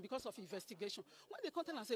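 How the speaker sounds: ordinary talk, nothing marked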